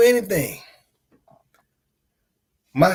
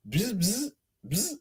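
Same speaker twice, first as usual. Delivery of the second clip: camera shutter buzz imitation; 4 semitones higher, 7 dB quieter, slower than usual.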